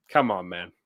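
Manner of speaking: normal talking voice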